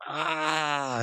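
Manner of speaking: drawn-out high "ah"; mock cry of pain. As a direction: imitate a faked agonized moan. Faked stomach pain, retold.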